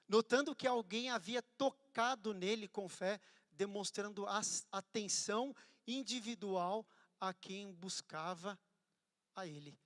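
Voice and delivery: loud, slow, driving sermon delivery; fervent preaching. Acted. Pastor preaching to the church, loud and fervent. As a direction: preach it out over the crowd.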